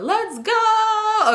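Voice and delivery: high, long-held rapper holler. Acted. Mimicking a rapper for laughs, high drawn-out holler. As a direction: stretch GO out high and full-voiced. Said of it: "Sound like [name]".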